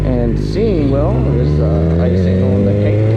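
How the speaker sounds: muffled helmet mic over engine; talking inside a motorcycle helmet. Real, not acted. Moto vlog recorded inside helmet.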